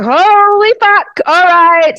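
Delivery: loud, high, drawn-out retold outburst; shocked exclamation. Recounting her reaction to falling fireballs, shocked exclamation.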